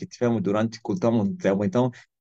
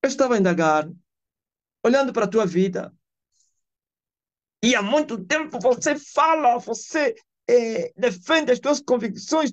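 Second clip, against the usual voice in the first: retold wondering reply; 13 semitones higher than usual. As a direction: marvel aloud at a man who never changed. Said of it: "O que eu falo?".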